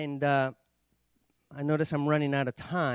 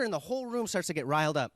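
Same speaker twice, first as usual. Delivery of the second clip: usual speaking voice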